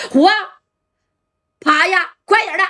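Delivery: high urgent yell to get down; warning shout. Acted. 王明和 warning his friend to drop before the gun, high urgent shout. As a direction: shout at him to hit the floor, fast. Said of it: "王明和就喊了".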